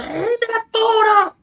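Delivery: high, slow shrill mother's scold; exasperated mother calling up; mama's scolding. Retold or imitated. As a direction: play the scolding mama, up high and drawn out. Mama scolding in retold story.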